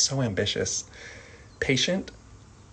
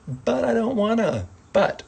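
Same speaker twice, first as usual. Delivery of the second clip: put-on whiny child; 11 semitones higher, 3 dB louder than usual. Child whine demo.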